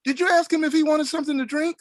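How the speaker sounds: very high imitated call across the house; Cicely yelling. Retelling a woman checking her partner offered a drink, high mock yell. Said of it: "I hear Cicely yell from the".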